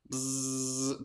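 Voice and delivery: soft, sustained imitated mosquito buzz. Shown as a demonstration. Pastor demonstrating a mosquito accent for families at home, buzzing. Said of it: "with a mosquito accent".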